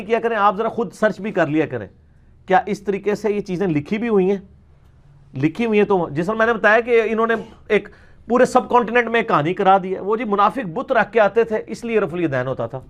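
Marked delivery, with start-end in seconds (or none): none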